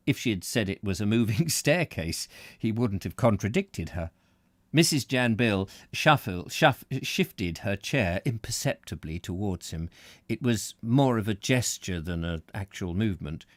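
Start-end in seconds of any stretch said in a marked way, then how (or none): none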